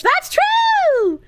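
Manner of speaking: squeaky voice. Acted. High voice agreement.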